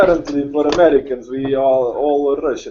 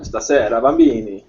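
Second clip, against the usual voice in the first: hammed-up fake Italian gibberish, a touch higher; mock Italian accent; 4 semitones higher, 4 dB louder than usual. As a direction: play an Italian with made-up words. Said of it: "now do the Italian".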